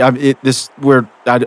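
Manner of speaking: ordinary delivery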